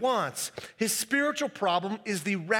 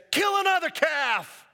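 high, loud and jubilant; father lifting his son, shouting for joy; joyful shout; 9 semitones higher, 5 dB louder than usual. Acted.

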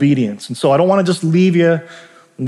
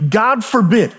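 ordinary talk, nothing marked

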